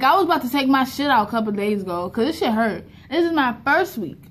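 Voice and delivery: ordinary delivery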